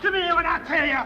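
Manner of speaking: angry order. Acted. Bully ordering Nigel.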